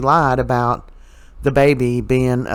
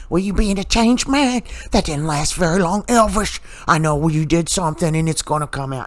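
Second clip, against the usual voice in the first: high-pitched mock scolding mother; imitating Susan scolding; 3 semitones higher than usual. Mother tears into her son E J, high mock scold. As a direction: lay into your son in a high, prissy mother voice. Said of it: "Susan is chewing into E J".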